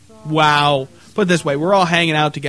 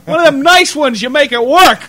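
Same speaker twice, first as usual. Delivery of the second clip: higher, louder mock demanding husband; bossy husband; 8 semitones higher, 7 dB louder than usual. Mocking the sandwich girl's boyfriend, demanding and loud. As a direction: bark orders like a lazy boyfriend. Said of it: "her old man just like".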